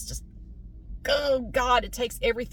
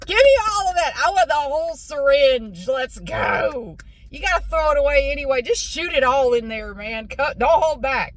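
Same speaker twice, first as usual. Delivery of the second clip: louder, higher, pushy and fired up; eager demands; 3 semitones higher, 7 dB louder than usual. Retold or imitated. Patient urging the dentist for more numbing, loud and eager. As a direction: demand the whole syringe, fired up.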